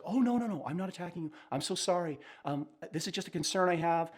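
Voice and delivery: imitating someone caught off guard, high and apologetic; apologetic backpedal. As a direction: rush to reassure, sorry you hurt them. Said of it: "the person is like".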